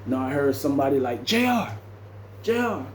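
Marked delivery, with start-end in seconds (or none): 1.2-2.9 higher, calling a name. Retold or imitated. Distant inmate calling him J R, higher. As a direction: voice calling through the cell.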